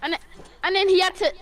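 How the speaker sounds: ordinary talk, nothing marked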